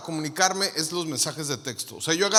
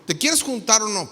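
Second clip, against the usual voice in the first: normal talking voice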